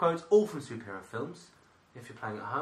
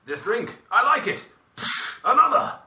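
higher, louder booming Thor; Thor quote; 11 semitones higher, 8 dB louder than usual. Acted. Quoting Thor's drink line in a booming voice. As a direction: bellow it like a jovial god. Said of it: "Number three".

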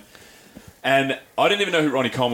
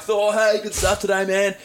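excited fan greeting; 8 semitones higher, slower than usual. Retold or imitated. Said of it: "We're like".

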